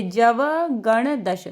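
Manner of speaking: जवगणदश spelled out slowly, syllable by syllable; slow model reading. Shown as a demonstration. Teacher models the sutra slowly for students to repeat. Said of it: "ऐसे ही पढ़ती जाइए।".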